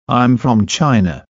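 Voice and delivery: usual speaking voice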